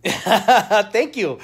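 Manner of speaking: laughing thanks. Real, not acted. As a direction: thank her mid-giggle.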